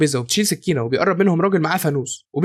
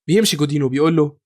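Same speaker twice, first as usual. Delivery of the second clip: plain reading voice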